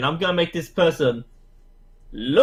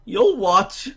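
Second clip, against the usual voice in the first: wizard showman voice; 7 semitones higher than usual. Acted. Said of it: "waves around like".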